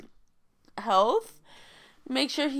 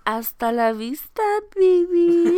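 movie catchphrase; 7 semitones higher, 10 dB louder, slower than usual. Pretend.

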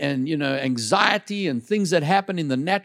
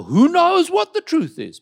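high rhetorical question; 13 semitones higher, 5 dB louder than usual. Acted. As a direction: ask "Who knows what the truth is?" much higher and louder.

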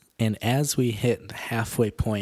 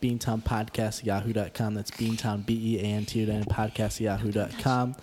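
usual speaking voice

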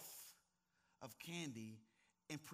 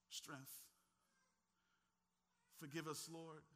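soft prayer; 5 dB quieter than usual. Acted. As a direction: pray gently after the storm. Closing prayer, soft and low.

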